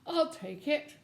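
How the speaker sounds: a bird grudgingly agreeing; owl voice. A narrator's reading.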